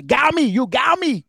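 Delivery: high, loud mock Chinese accent, hammed up. Imitating a Chinese accent after his friend keeps saying the phrase. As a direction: blurt it in a put-on Chinese accent. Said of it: "full Jackie Chan for a second".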